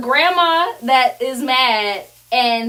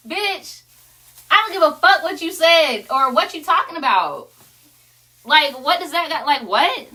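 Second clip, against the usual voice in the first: high, sharp, laughing put-down; sassy clapback; 4 semitones higher than usual. Real, not acted. Wife mocking the birthday mom with a high sassy clapback. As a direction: talk back to her, mocking. Speaker venting at mom, higher.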